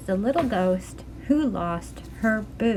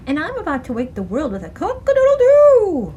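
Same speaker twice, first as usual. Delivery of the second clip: 1 proud rooster; 5 semitones higher, 7 dB louder than usual. Acted. Rooster boasts about crowing.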